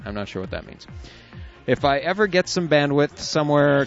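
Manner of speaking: ordinary delivery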